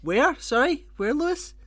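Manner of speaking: high, loud baffled questions; mock incomprehension. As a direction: call out as if you missed it. Feigns not understanding the accent.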